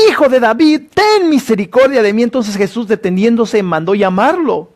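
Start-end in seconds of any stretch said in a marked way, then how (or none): 0.1-2.2 a higher pleading cry; crying louder. Retold or imitated. Blind man calling out over those silencing him, pleading cry. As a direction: keep begging, calling out over the crowd. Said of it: "él clamaba mucho más fuerte".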